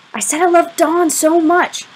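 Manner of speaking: high, slow, insistent declaration; insistent repeat. A narrator's reading. Boy repeating his confession to unresponsive friend, insistent. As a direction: repeat it pointedly, pitched well up.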